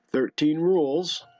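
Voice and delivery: usual speaking voice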